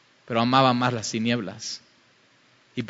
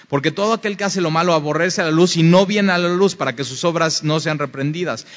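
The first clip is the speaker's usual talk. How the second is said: ordinary talk, nothing marked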